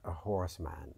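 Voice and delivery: usual speaking voice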